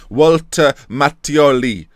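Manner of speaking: put-on slow, deliberate Italian accent. Gamer having another go at the name, Italian accent. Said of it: "I'm a lot more Italian".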